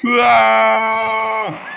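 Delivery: loud cry of pain; sudden cry of shock; pained shout. Real, not acted. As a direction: shout out as you get hit. Hit in game.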